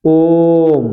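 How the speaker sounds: long drawn-out ओम with held vowel; prolonged om. Shown as a demonstration. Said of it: "आपको मिलेगा".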